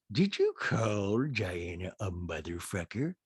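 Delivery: low, very slow drawl; Rinna. Retold or imitated. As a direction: drag the question out in a low put-on voice. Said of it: "And then Rinna goes".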